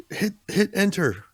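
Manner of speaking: high, slow hacker order; drawn-out high command; slow excited command. Pretend. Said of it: "[name] is gonna be like".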